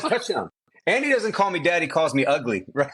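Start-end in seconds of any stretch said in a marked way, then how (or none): 0.0-0.5 a light, high imitation of a two-year-old; "Touchdown." said lightly in a higher, small toddler voice. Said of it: "He says".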